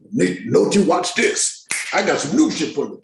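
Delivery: hammed-up showboating; cocky show-off actor. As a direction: play a cocky newcomer showing off. Mock boast he never made.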